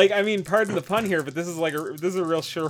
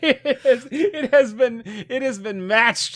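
laughing; 7 semitones higher, 7 dB louder, slower than usual. Real, not acted. Announcing matched roll, laughing.

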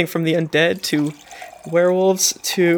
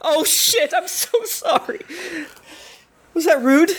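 high startled oh shit then laughing sorry; flustered high apology; shocked apology; 10 semitones higher than usual. Real, not acted. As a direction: suddenly realize the gaffe, voice jumping up. GM realizes she insulted player.

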